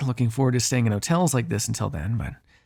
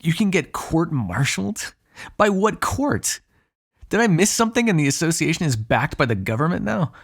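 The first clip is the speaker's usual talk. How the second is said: high-pitched, scoffing disbelief; incredulous; 4 semitones higher than usual. Acted. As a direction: question it as if utterly absurd, voice lifted. Incredulous about court-martial.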